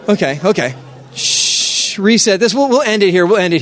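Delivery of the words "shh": drawn-out hushing hiss; long shush. Real, not acted. Speaker resetting the excited crowd, long shush. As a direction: shush the whole room. Quieting the crowd during game.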